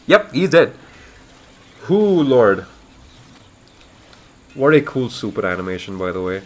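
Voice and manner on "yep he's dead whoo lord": ordinary delivery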